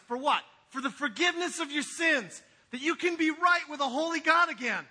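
high, loud, driving sermon delivery; preaching at full force. Acted. Pastor urging first-time visitors toward God, full preaching voice. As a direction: preach it out to the whole room.

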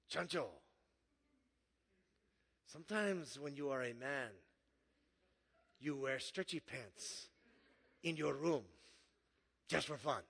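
put-on quiet, low movie-quote voice in a Nacho Libre accent. Preacher quotes a movie line to the congregation, Nacho Libre accent. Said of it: "that was a perfect accent".

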